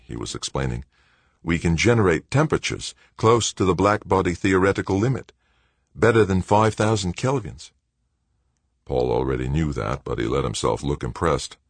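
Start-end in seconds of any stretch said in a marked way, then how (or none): 1.4-7.7 put-on young Australian man. A narrator's reading. Director's voice.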